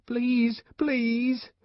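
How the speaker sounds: put-on high-pitched child begging; crying plea. A narrator's reading.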